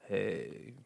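low husky sultry hey; a croaky flirt. Acted. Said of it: "croaky voice today. Husky voice".

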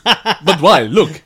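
loud, giggling through the words; laughing while quoting. Retold or imitated. Retelling the shopkeeper's reply, laughing through it. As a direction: crack up as you repeat it. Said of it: "he went".

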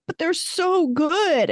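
high, drawn-out kid imitation; mock whiny protest, stretched out; pleading daughter. Daughter defends chicken fingers, retold.

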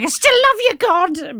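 high, loud mock cry of devotion; shouted mock devotion; devoted protest. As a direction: call it up to the heavens, hammed-up. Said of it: "Job was like".

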